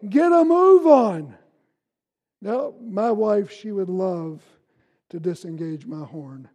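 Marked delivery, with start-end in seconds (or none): 0.1-1.3 loud, slower mock yell at the car ahead; impatient shout. A preacher playing the impatient driver, shouting at the car ahead. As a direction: yell at the dawdling driver, half joking. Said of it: "And I'm saying".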